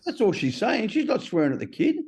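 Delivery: high-pitched, insistent defence of her; high, emphatic correction; retold reproach. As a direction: imitate a sponsor setting him straight. Sponsor defending wife in retelling.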